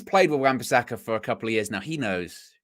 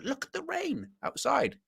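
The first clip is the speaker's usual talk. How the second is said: high, slow, softer parent voice; amazed parent; 12 semitones higher, 8 dB quieter, slower than usual. Acted. Parent walking into your room to point out rain, amazed. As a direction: marvel at it slowly, pitched up high. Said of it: "parents always do it ... walk into your room".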